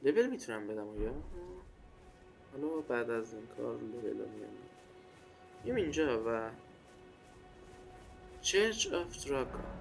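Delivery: ordinary talk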